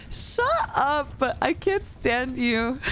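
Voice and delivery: playfully fake-dismissive, not meaning it; mock annoyed. Streamer teasingly tells her friend to shut up. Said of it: "I'm like".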